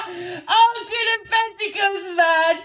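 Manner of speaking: high, loud laughing exclamation. Real, not acted. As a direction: crack up at the card. Cackles at the card drawn.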